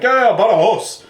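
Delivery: put-on Spanish accent. Voices the ticket guy.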